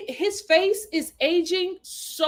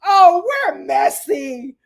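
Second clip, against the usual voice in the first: savoring drama; 3 semitones higher, 4 dB louder, much slower than usual. Real, not acted. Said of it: "I said".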